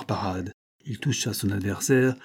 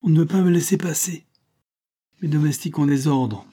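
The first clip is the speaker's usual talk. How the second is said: indignant complaint; 6 semitones higher, 5 dB louder than usual. A narrator's reading. Lieutenant complains at being barred.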